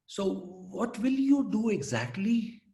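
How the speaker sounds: slow, high sarcastic drawl; a mocking parent asking what now; sarcastic mother. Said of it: "full of sarcasm".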